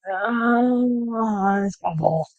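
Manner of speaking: ordinary delivery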